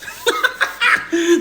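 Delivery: real high-pitched cackle of laughter; bursting laugh. Real, not acted. Storyteller cracking up at old lady's challenge, real laughing. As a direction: crack up helplessly.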